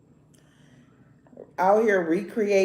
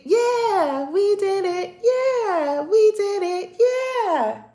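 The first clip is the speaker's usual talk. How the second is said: much higher sing-song cheer; Dora the Explorer; 10 semitones higher, 3 dB louder, slower than usual. Retold or imitated. Vlogger celebrating her nails like Dora the Explorer, high sing-song cheer. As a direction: cheer like a cartoon kid celebrating. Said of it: "Like Dora the Explorer".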